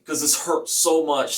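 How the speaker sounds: normal talking voice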